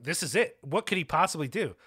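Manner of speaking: usual speaking voice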